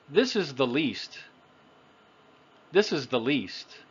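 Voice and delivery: "least" with a long ee vowel; "This is the least", ee as in "see"; long E sound. Shown as a demonstration. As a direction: hold the ee in "least". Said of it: "one has a long sound".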